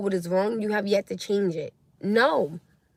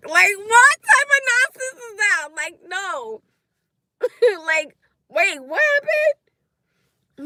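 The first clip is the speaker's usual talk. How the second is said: much higher, louder disbelief; incredulous outburst; 10 semitones higher, 4 dB louder, slower than usual. Real, not acted. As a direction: squeal in total disbelief. Disbelief at contradictory comments.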